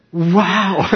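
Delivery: high, loud, laughing wow; a laughing shout of awe; amazed wow. Retold or imitated. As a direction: gasp at the news in delight. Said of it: "I go online and I go".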